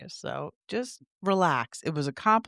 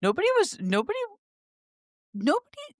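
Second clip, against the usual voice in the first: exasperated nobody; faster than usual. Real, not acted. Emphatic exasperated repetition.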